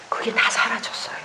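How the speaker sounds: breathy whisper of relief. Real, not acted. Pastor telling the congregation her burden is gone, whispered. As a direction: let it fall to a soft whisper.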